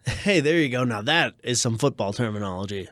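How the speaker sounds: ordinary delivery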